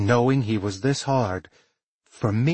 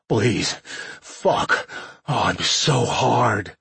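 labored, ragged pleading in broken pieces; slower than usual. A narrator's reading. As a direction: beg through heavy breathing, drawing it out. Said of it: "his voice was ragged".

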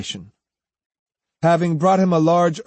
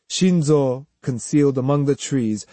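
usual speaking voice

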